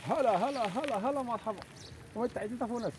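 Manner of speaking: high sing-song cooing at a pet. Real, not acted. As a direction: coo softly at a little animal. Greeting an animal, cooing. (مناغاة بصوت عالي الطبقة لحيوان صغير)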